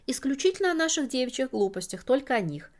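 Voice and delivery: light, lilting sweet chatter; chirping. A narrator's reading. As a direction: chirp it out like a society flirt. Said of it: "прощебетала Лола".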